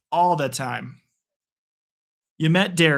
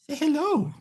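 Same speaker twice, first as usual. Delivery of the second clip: much higher, softer sing-song with altered tone; pet talk; 12 semitones higher, 4 dB quieter than usual. Real, not acted. Repeating the request to the puppy for the viewers, high cooing. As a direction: coo it a second time to the dog. Asking dog to greet viewers.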